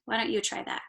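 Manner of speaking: ordinary delivery, nothing marked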